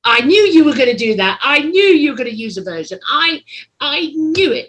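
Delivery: loud, high, quick burst of "I knew it"; triumphant exclamation. Real, not acted. Host catching the guest dodging her question, triumphant exclamation.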